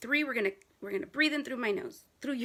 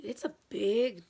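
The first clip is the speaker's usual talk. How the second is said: ordinary talk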